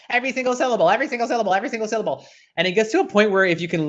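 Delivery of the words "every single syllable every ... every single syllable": "Every single syllable" rattled off quickly on a high pitch; brisk, high, repeated chant of "Every single syllable"; quick syllable drill. Shown as a demonstration. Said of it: "Come on, have some fun".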